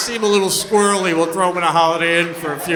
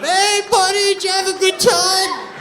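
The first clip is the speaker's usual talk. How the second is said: much higher, louder sing-song hello to a dog; cheery greeting; 12 semitones higher, 5 dB louder than usual. Retold or imitated. Owner greeting his dog at the kennel, high and cheerful.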